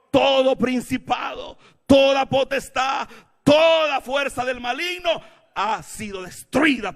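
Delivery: high, loud, slow declaration; emphatic preaching shout. Real, not acted. Preacher declaring evil destroyed, emphatic shouting. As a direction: hammer out each phrase.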